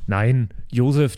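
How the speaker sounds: normal talking voice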